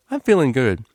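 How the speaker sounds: ordinary delivery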